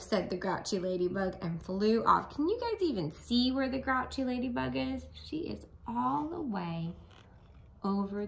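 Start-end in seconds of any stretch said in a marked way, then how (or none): none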